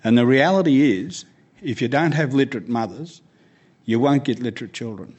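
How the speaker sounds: ordinary talk, nothing marked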